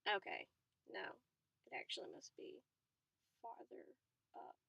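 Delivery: whispered to herself; thinking aloud. Real, not acted. Reader working out the map, whispering to herself. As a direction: mutter it under your breath. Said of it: "I was thinking to myself".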